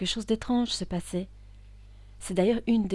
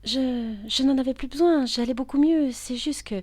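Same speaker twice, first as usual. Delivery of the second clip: higher, faltering self-justification; defensive; 6 semitones higher, 7 dB louder than usual. Acted. Patient justifying herself to her psychiatrist, defensive. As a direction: protest hesitantly, pitched up.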